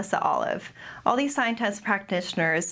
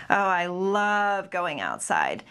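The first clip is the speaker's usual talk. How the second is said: slow, drawn-out sarcastic LOVE; heavy eye-rolling sarcasm, stretching out "love"; exaggerated sarcasm; slower than usual. Shown as a demonstration. Presenter demonstrating the first training trials, exaggerated sarcasm. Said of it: "very exaggerated, um, eye rolling and, um, intonation of voice".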